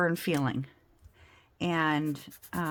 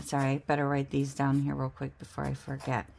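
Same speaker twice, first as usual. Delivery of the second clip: ordinary talk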